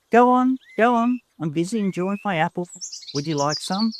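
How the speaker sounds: high, loud teasing call, then fast; teasing cousin. A narrator's reading. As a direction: tease from the shade, rushed and playful.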